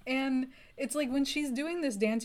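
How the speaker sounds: usual speaking voice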